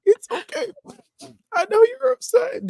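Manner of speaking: high, wailing crying-mom voice; mock sobbing. Crying mom comforting her spanked kid, high wailing sobs. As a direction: sob it out high like a weepy mom. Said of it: "bawling her eyes out".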